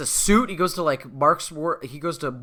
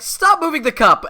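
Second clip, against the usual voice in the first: much higher and louder snap; bratty old Binky yell; 12 semitones higher, 8 dB louder than usual. Retold or imitated. Binky losing his dad act, yelling at Buster. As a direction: bark it like a bully losing patience. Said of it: "he reverts into old Binky".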